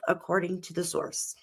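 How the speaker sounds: fighting not to cry while reading; holding back tears. Real, not acted. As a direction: voice sinking to a near whisper. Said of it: "Sorry, I'm trying not to cry".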